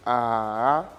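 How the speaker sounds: A held long and low, then swooping up; dipping third tone. Shown as a demonstration. Teacher demonstrating the third tone, A falling then rising. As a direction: sink low on A, linger, then climb at the end. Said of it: "Vous descendez et puis vous montez".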